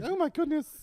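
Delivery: high, loud, fretful droid exclamation; C-3PO. Acted. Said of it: "he's like".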